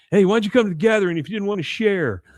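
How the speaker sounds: high, loud, quick challenge; mock-indignant rapid retort; confrontational. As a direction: act out picking a quarrel. Acted quarrel at the circle.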